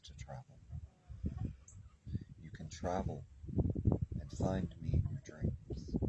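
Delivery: gentle channeling voice, half whispered; channeled Spirit message. Acted. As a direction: comfort a frightened child as a protective spirit.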